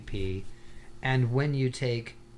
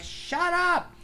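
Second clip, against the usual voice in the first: very high mock snap; squeaky annoyed shut up; exasperated shut up. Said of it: "I'm like".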